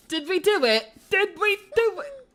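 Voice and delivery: high, loud, hopeful asking; bursting to know if it worked; excited. Real, not acted. Player checking if the puzzle is solved, excited and loud.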